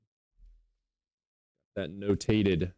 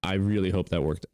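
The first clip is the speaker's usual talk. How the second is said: ordinary talk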